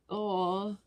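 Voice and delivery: ordinary delivery, nothing marked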